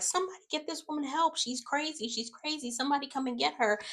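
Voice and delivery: high, quick mock plea for help; imitated urgent appeal. Retold call for help about woman.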